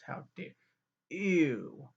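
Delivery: a hushed how dare then a loud ew; mock disgust. Grossed out by the twins, mock-offended ew. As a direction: sneer it like a pearl-clutcher. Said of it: "being sarcastic".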